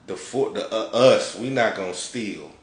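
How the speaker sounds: usual speaking voice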